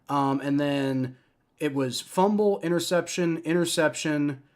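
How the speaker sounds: ordinary talk, nothing marked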